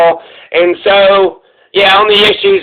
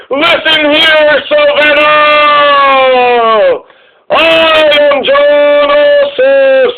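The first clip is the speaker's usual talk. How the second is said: higher, very slow, grandstanding rally voice; mock Jon Ossoff speech; 8 semitones higher, much slower than usual. Mocking a candidate's rally speech for viewers, slow high grandstanding. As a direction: stretch every word out like a showy stump speech. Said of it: "Jon Ossoff is like".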